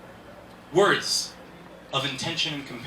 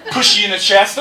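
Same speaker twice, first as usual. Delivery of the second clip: loud high laughing command; mock dwarf rancher; 7 semitones higher, 11 dB louder than usual. Comic dwarf shoving a person like a horse. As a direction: ham up a tiny rancher shoving you.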